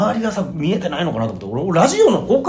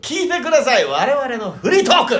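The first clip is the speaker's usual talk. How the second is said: mock proclamation; 7 semitones higher than usual. Mock address to the audience.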